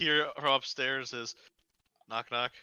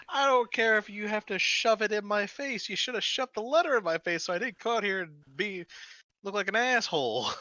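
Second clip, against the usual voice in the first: high, heated rant; angry scolding; 9 semitones higher than usual. Acted. Brewmaster berating clerk over undelivered letter, angry scolding. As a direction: tear into an employee, pitch raised.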